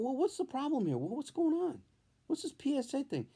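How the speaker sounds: a hint of a jittery patient; nervous. Patient waiting alone in the exam room, mildly nervous.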